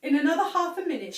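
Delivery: plain reading voice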